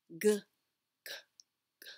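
"g" whispered until it turns into "k". Shown as a demonstration. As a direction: breathe "g" without voice, no throat buzz. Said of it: "whisper the G sound".

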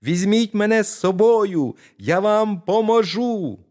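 put-on high, loud little-boy voice, slowing at the end; a tiny lad eager to help; tiny boy's voice. Tiny boy offers to help the thieves, high and eager. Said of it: "А мізинчик до них знову".

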